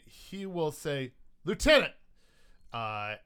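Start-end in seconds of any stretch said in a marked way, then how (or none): 1.4-1.9 loud, high hail to a lieutenant; calling out. Acted. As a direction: call sharply for your officer. Officer hailed in game.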